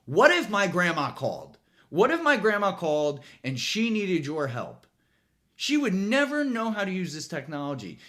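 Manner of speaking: indignant rant. Real, not acted. Ranting at Verizon.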